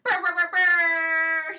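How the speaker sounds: loud booming brr sounds; bass thump noise. Pretend. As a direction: shout out a car bass rhythm. Imitating a car stereo bass.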